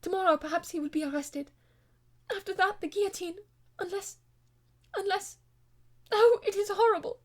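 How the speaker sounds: high, loud anguished pleading; a woman voiced high in despair; distressed lady. Marguerite distraught over brother's fate.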